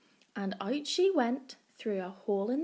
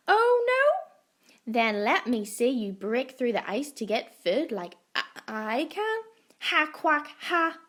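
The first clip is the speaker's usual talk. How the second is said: taunting duck; 6 dB louder than usual. A narrator's reading. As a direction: tease her like a cocky duck. Duck mocks Shelley.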